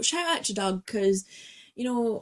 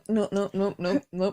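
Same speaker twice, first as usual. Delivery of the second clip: ordinary talk, nothing marked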